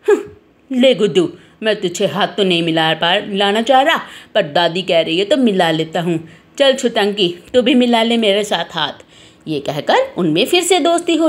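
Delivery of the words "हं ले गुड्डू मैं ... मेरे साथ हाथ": low-pitched reluctant truce; acted sulky concession, pitched down; grudging boy. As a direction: give in grudgingly to make peace with a playmate. Banku grudgingly makes up.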